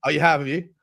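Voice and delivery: ordinary talk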